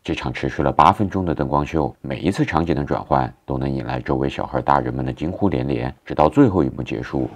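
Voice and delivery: calm, even travel-film narration; documentary voiceover. A narrator's reading. Vlogger narrating the castle light show for viewers, calm voiceover. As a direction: narrate it like a polished vlog voiceover.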